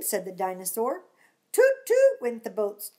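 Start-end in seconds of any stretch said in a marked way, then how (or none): 1.5-2.2 very high loud toot; high loud mock boat toot; boat horn. Boats hooting.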